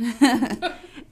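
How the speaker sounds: bright high laugh. Real, not acted. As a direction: burst out laughing. Laughing at remark.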